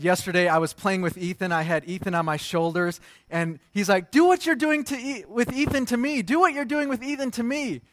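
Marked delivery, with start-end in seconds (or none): 4.1-7.8 put-on high, pleading little-boy voice; put-on high child's pestering; imitated jealous child. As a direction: beg for a turn like a jealous kid. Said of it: "you know, the jealousy thing".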